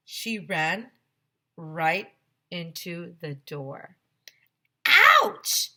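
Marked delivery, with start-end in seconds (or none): 4.9-5.7 high loud yelp; clown lady's ouch. Acted. Clown lady hitting the door, high loud yelp. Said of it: "said the clown lady".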